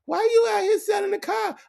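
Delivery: very high falsetto question; high mocking woman voice; mock woman's voice. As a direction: squeak it like a girlfriend. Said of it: "and she say".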